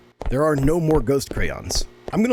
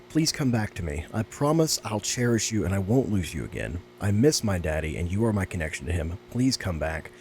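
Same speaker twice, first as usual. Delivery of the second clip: soft, lower letter reading; reading a sad letter; 4 semitones lower, 4 dB quieter than usual. Acted. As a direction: read the child's note gently, a touch sad. Reads diary note aloud.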